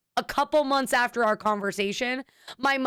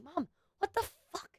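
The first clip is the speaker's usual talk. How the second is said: muttered disbelief; 6 semitones higher, 11 dB quieter than usual. Retold or imitated. Exasperated retort to mother.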